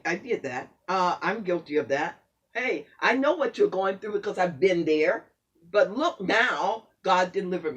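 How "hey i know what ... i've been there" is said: ordinary talk, nothing marked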